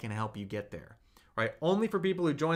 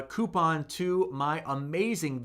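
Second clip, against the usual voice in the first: ordinary talk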